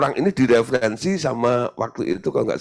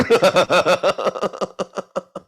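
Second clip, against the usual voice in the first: long, high belly laugh; real laughter; 7 semitones higher, 3 dB louder than usual. Real, not acted. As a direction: burst out laughing at your own punchline. Laughs at own story.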